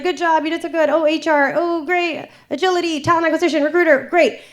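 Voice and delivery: high, bubbly mock praise from the crowd; gushing praise. Attendees praising the speaker after the talk, high and gushing. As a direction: gush like an excited fan. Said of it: "Everyone's like".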